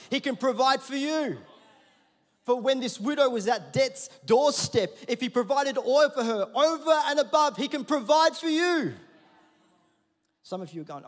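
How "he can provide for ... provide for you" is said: loud, high rising preacher build; preaching at full force. Real, not acted. Pastor assuring the church God provides, full-force preaching. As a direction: drive it home to the congregation.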